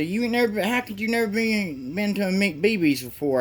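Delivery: high mocking yokel voice; mock hostile local. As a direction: sneer it up high like a suspicious local. Hostile diner staff quizzing her.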